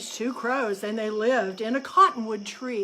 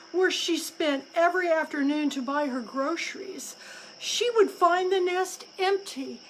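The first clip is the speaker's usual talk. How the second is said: ordinary delivery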